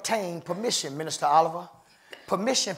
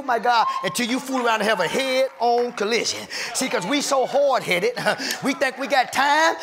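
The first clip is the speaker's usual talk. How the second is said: raised-pitch, rhythmic sermon delivery; fervent preaching; 5 semitones higher, 3 dB louder than usual. Real, not acted. Preacher warning the hard-headed of a collision, fervent. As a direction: drive each phrase high and hard, like a revival preacher.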